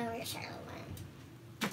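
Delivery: hushed joking whisper. Real, not acted. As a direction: whisper it like a secret. Whispered joke, sister hiding.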